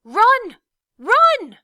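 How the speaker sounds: shouted high and very loud; a desperate shout of warning; crying out. A narrator's reading. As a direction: yell a warning to flee. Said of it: "a voice cried out".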